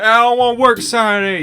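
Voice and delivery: high, loud hammed-up protest; whiny mock protest. Worker protests Saturday shift to the boss, whiny. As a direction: wail it like an overdramatic kid.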